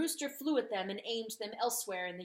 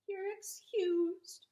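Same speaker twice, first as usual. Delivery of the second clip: mild meek dog voice; 10 semitones higher, much slower than usual. A narrator's reading.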